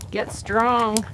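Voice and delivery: ordinary delivery, nothing marked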